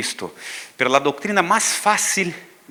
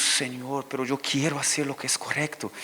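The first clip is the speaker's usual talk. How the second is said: softer, almost whispered plea; hushed prayer; 9 dB quieter than usual. Retold or imitated. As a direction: confess quietly to the Lord. Quiet prayer after failing. (oración en voz muy baja)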